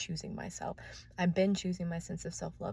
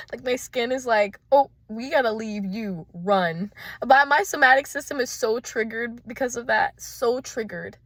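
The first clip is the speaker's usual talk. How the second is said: excited; 3 semitones higher, 8 dB louder than usual. Real, not acted. Agitated loud rant.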